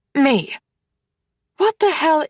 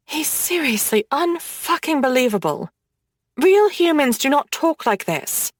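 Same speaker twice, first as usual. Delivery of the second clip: ordinary talk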